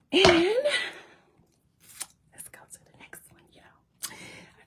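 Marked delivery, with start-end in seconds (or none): 2.3-4.6 breathy whispered aside to the viewers. Real, not acted. Whispering to viewers about the receipts. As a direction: lean in and whisper it.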